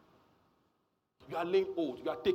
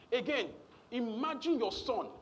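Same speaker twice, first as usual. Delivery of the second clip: normal talking voice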